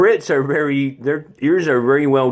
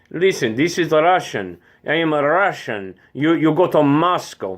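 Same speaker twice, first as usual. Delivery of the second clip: put-on higher-pitched Russian comrade's accent; 5 semitones higher than usual. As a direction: warn them like a Soviet comrade with a Russian accent. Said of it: "one of my favorites is the Russian".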